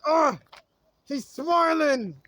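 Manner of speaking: slow, sneering mock grumble; mocking annoyed critic. Preacher mimics angry viewers who hate his smile, mock grumble. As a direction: put on a sour, disgusted critic. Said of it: "there's people that are angry that you're smiling".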